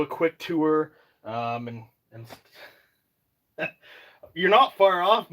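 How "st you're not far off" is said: laughing. Real, not acted. Laughing at viewer's guess.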